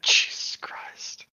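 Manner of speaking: breathless shocked whisper. Real, not acted. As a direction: breathe it out in disbelief. Shocked reaction.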